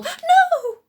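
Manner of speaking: very high, loud scream. Acted. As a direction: scream it in horror. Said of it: "screamed Luba".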